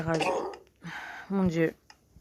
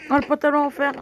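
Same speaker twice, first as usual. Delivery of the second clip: high, loud delighted cry; thrilled at the loot; excited; 8 semitones higher, 6 dB louder, faster than usual. Real, not acted.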